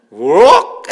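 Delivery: loud startled gasp; shocked cry. Retold or imitated. Child is stunned by mother's switch, loud gasp. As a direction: blurt out a shocked huh. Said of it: "했다는 거예요".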